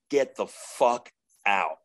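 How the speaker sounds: slow, weighty command; bouncer's firm order. Retold or imitated. Telling a demon to leave like a bartender, firm. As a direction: throw out a drunken party guest, word by word. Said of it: "like I was throwing a bozo out".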